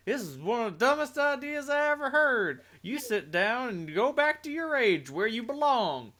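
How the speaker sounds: high-pitched, indignant telling-off; swooping high-pitched rebuke; scolding other personality. Acted. Acted scolding from another personality.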